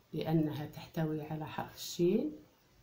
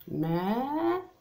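very slow, higher ماء, stretched long; drawn-out word; 5 semitones higher, much slower than usual. Shown as a demonstration. Teacher reading the word aloud to the class, drawn out. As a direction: sing out ماء slowly. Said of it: "teacher sounds out the word".

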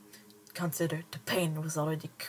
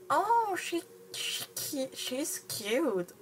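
gushing; 10 semitones higher, much slower than usual. Real, not acted. Reader gushes over the creature.

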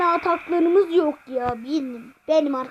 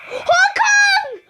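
excited shout; 9 semitones higher than usual. Real, not acted. Excited at a near goal.

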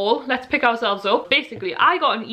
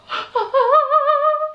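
long, high, excited gasp; delighted gasp; 4 dB louder than usual. Real, not acted. Fan seeing Audrey's book up close, gasping with delight.

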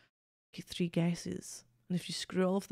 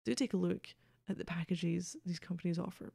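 ordinary delivery

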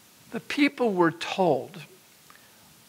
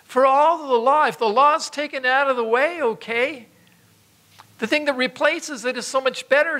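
louder and higher, building to a peak; preaching at full force; 6 semitones higher, 4 dB louder than usual. Acted.

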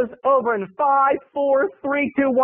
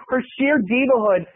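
usual speaking voice

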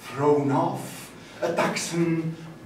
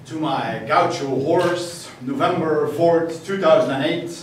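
loud, declaimed "two thousand and eight" with "thousand" stressed; declaimed dedication; 3 semitones higher, 8 dB louder than usual. Acted. Poet dedicating the poem to his horse, loud and declaiming. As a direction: announce the date like a poem title. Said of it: "poem dedication declaimed".

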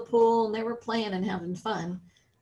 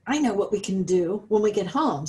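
normal talking voice

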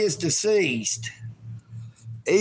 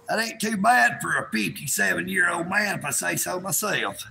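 normal talking voice

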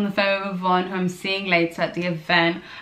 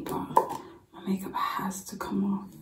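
late-night whisper so nobody wakes; 7 dB quieter than usual. Real, not acted. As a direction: hush so you don't wake anyone. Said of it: "sorry if I'm whispering, everyone's asleep".

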